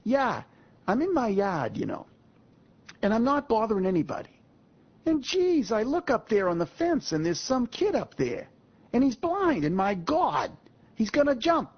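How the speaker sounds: high, excited Boston accent imitation; Boston guy shouting in alarm. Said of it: "he does it in his accent".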